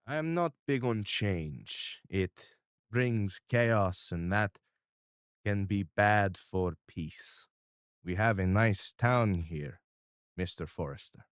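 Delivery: lightly voiced calm mob boss; Mister R, measured. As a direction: speak like a calm, controlling patriarch. GM voicing Mister R.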